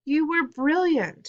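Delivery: high, slow, emphatic delight; gushing praise. A narrator's reading. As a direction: heap praise with excitement. Dad gushing after the play.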